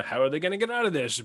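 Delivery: ordinary talk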